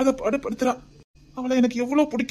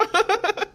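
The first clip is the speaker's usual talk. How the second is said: joyful laugh; 11 semitones higher than usual. Acted. Overjoyed new father.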